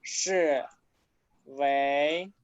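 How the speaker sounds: 我的儿子是为哪位 stretched slowly; slow dictation. Shown as a demonstration. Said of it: "slow dictation of sentence". (dictando despacio)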